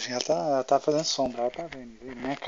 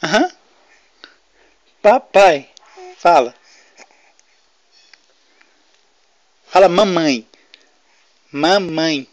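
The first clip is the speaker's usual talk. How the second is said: loud coaxing call; 5 semitones higher, 11 dB louder, much slower than usual. Real, not acted. Father coaxing baby to talk.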